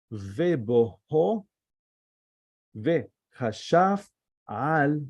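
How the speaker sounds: slow chunked Hebrew from "Ve'haaretz" to "Elohim", pauses between words; demonstrated pronunciation. Shown as a demonstration. Teacher continuing the reading for students to hear, slow careful pronunciation. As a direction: read "Ve'haaretz hayta toho" syllable by syllable, lifting the word endings. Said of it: "hear my pronunciation".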